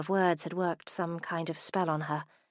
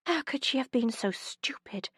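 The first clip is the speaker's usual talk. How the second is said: a higher, voiceless whisper of self-reproach; 6 semitones higher than usual. Acted. Heroine berates herself, higher voiceless whisper. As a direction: hiss it to yourself in shame.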